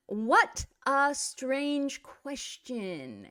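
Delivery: very slow, emphatic exclamation; drawn-out exclamation. Shown as a demonstration. Teacher models an exclamation for students, slow and emphatic. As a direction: stretch out "What a strange question" word by word.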